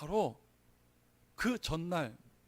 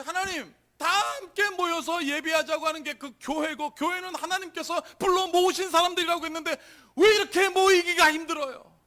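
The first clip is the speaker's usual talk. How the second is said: high, loud lamenting prayer; re-enacted crying out; 9 semitones higher, 10 dB louder than usual. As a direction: shout your complaint up to God, re-enacting it. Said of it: "막 탄식하면서 막 그냥 소리 지르면서".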